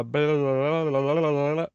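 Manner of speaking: tongue-rolling run of blah-blah babble; babbled blahs. Real, not acted. Host stuck on an actor's name, babbling nonsense. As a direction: babble to fill a memory blank.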